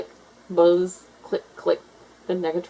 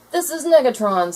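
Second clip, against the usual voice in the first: proud announcement; 7 semitones higher, 5 dB louder than usual. A narrator's reading. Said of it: "said Buster".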